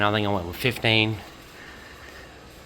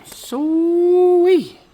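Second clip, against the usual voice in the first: high, loud sweet of delight; pleased cheer; 8 dB louder than usual. Real, not acted. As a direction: celebrate the finished job. Shocks fitted, pleased.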